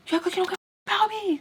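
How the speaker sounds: high, rapid mock wail of complaint; imitated upset outburst. Storyteller imitating her ex accusing her of not caring, high rushed outburst. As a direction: rush it out high, as a retold whine.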